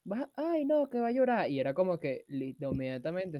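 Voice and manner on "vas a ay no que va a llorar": mocking parent. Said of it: "a los niños con pene".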